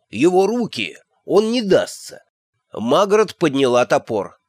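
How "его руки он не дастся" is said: urgent warning. A narrator's reading. Shawn calls out warning.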